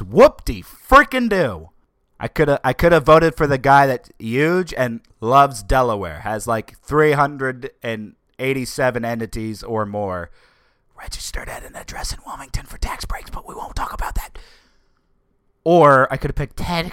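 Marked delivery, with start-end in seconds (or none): 0.0-1.7 slow, loud, high mock cheer; sneering fake enthusiasm; sarcastic whoop-de-do. Real, not acted. Mocking the candidate choices. 11.0-14.4 conspiratorial whisper; hushed gossip whisper; whispered aside. Real, not acted. Secret aside about Trump.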